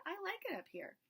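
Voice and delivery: put-on a stubborn small girl up a tree; little girl voice. Little girl refusing the wise men, high and childlike.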